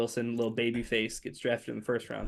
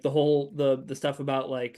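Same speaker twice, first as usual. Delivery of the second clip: usual speaking voice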